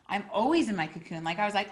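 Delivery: usual speaking voice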